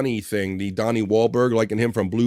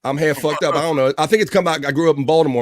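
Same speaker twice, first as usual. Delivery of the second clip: ordinary talk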